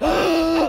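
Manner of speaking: sharp high gasp; pained shocked gasp. Acted. Storyteller acts Brackett taking the knife, shocked gasp. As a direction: gasp as if just stabbed. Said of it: "Brackett's just like".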